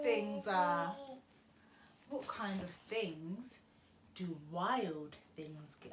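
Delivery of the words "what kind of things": ordinary delivery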